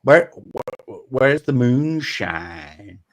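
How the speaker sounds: put-on drawled Southern accent. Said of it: "Put the drawl".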